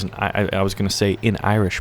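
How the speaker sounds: usual speaking voice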